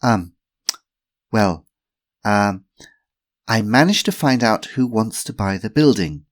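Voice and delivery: nervous before a group; nervous speaker. Acted. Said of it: "cleared her throat a couple of times before beginning".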